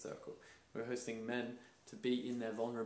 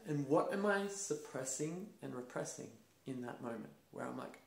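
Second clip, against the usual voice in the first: ordinary delivery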